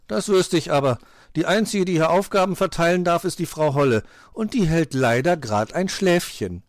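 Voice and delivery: loud, high-pitched sass; cheeky. A narrator's reading. Pechmarie refuses fox's orders, cheeky. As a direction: talk back impudently at full voice. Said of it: "sagte sie frech".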